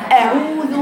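ordinary delivery

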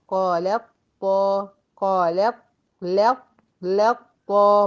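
syllable drill; isolating the merge. Shown as a demonstration. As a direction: drill لط طا slowly. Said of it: "بدخل من اللام المرققة على الطا".

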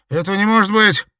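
high, loud shout of disbelief; panicked shout. Acted. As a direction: cry out in terror. Terrified victim's outburst. (испуганный крик)

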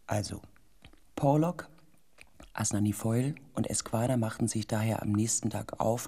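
hushed, ominous narration; story narration. A narrator's reading.

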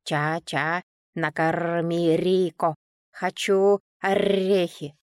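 slow, creaky parrot squawk. A narrator's reading. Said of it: "мерзким скрипучим голосом".

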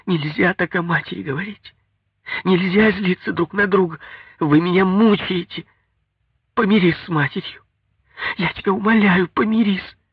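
very high, distressed wife's voice; upset, imploring, high-pitched reproach; tearful pleading. A narrator's reading. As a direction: beg desperately, pitched far up. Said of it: "А она в слёзы".

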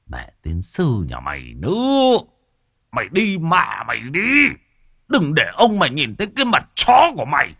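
put-on old father cursing his drunk son. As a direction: rail at the son and the wife.

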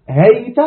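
هيت said "hayta"; fatha ending. Shown as a demonstration. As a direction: open "a" closing هيت. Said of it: "إما مبني على الفتح".

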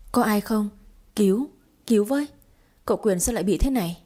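a touch of frantic crying for help; panicked scream. A narrator's reading. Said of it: "tiếng hét thất thanh của Trúc". (tiếng kêu cứu hoảng hốt, hơi to)

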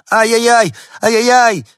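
very high, loud sustained wail; a desperate little dog's lament; wailing cry. A narrator's reading. Small dog on the balcony wails loudly from above. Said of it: "причитала".

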